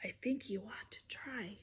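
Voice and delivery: soft breathy whisper; hushed soft story whisper. Shown as a demonstration. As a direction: whisper like a butterfly. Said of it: "Mister Brown can whisper".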